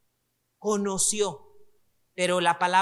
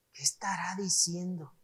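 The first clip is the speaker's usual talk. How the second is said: a baffled reader wondering to himself in a hush; student imitating a literal-minded reader, whispered; puzzled whisper; 4 semitones lower, 8 dB quieter than usual. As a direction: whisper it, confused by the phrase.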